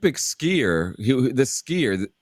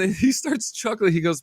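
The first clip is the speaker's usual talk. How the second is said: normal talking voice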